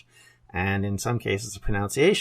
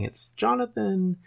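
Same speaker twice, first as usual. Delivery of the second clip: higher, slow mock of a teasing grandfather; 10 semitones higher than usual. Grandfather teasing young grandson about his Welsh name, mock teasing. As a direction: drawl it out like a grandpa teasing a boy. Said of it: "which he teased me by saying".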